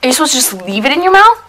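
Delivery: high squeaky and loud; helium voice. Real, not acted. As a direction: talk with a lungful of helium. Helium voice while inhaling.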